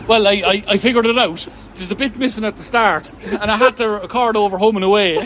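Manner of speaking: retold motherly explanation, high and insistent; imitated mum. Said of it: "And she goes".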